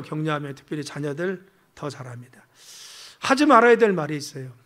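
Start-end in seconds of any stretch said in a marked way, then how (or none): none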